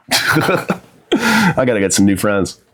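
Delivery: slow, laughing through the words. Real, not acted.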